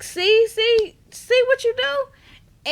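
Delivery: high, loud, slow exasperated father; a hammed-up dad blaming her; scolding dad. The dad blaming Mo, high, slow and exasperated. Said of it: "the daddy was like".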